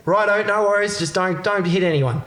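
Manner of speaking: high, relaxed cop voice; lightly voiced easygoing cop. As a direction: pitch it up, laid-back and kindly. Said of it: "He says".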